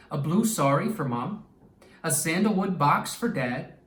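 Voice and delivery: ordinary delivery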